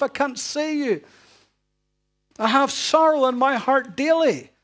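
high and loud despairing rant; loud, pained, accusing lament; ranting at God. Retold or imitated. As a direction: pour out your anger and hurt at God. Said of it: "Talk about not being able to trace his hand".

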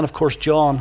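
usual speaking voice

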